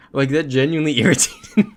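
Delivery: loud, high, breaking into laughter; exasperated but cracking up; laughing exasperation. Real, not acted. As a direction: admit your irritation through a laugh. Laughs while admitting irritation.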